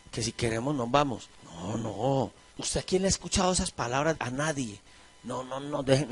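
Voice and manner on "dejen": ordinary talk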